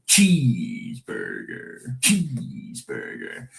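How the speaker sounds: "Cheeseburger" very slow, the "chee" long drawn out; peculiar "cheeseburger". Shown as a demonstration. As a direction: drag out the first syllable of "Cheeseburger". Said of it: "Very peculiar way cheeseburger is pronounced".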